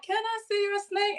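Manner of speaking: put-on little girl voice. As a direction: sound like a tiny curious girl. Child self asking stranger.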